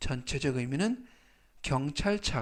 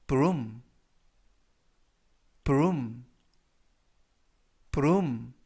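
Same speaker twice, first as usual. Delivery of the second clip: slow model reading; much slower than usual. Shown as a demonstration. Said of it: "천천히 세 번씩 읽어드리고요".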